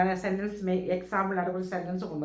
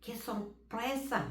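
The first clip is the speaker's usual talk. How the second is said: very slow and softer Spanish exclamation; soft slow repeat; 11 dB quieter, much slower than usual. Shown as a demonstration. Teacher repeating the example to students, slow and soft. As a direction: repeat it gently and slowly. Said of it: "അതുപോലെ".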